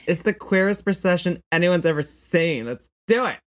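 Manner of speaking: high, loud, sassy procession leader; rally the parade in a high-pitched camp voice; campy queer voice. Acted. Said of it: "you were doing a queer voice".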